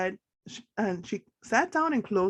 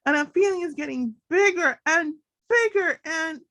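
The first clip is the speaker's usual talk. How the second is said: angry shouting; 8 semitones higher, 5 dB louder than usual. Acted. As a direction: let the fury keep growing. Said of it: "I'm so angry".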